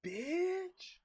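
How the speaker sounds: high, drawn-out opener, then a loud telling-off; acted indignant retort. Streamer acting out a comeback to a nosy store customer, indignant. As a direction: play up outrage at a busybody.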